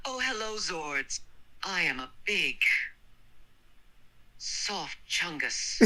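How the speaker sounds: mildly goofy reading, high at the start; understated silly voice; goofy, high-pitched. A narrator's reading. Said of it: "read with a goofy, high-pitched voice".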